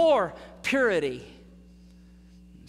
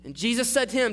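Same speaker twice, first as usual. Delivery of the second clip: usual speaking voice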